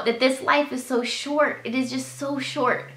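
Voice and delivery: normal talking voice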